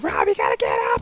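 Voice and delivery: imitated frantic woman's yelp; desperate voice. Fighter imitates his sister at ringside, desperate voice. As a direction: squeal it like a panicked supporter. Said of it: "that desperate voice you can hear".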